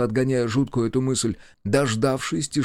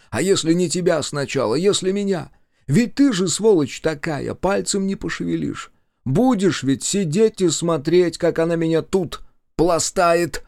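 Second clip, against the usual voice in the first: higher, building accusation; mounting, raised-pitch conviction; increasingly sure; 6 semitones higher, 4 dB louder than usual. A narrator's reading. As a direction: grow more certain with every word. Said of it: "говорил всё уверенней и уверенней".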